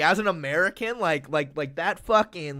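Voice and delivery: usual speaking voice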